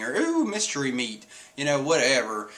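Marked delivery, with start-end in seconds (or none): 0.2-1.2 higher, delighted coo; delighted Luffy. Retold or imitated. Luffy's usual delight at mysteries, higher coo. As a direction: drool over the idea. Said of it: "how Luffy's always like".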